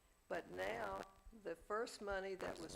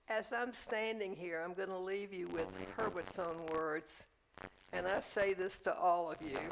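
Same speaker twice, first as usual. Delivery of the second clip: formal speech; 6 dB louder than usual. Retold or imitated. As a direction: rouse the room with a final blessing. Retold memorial speech.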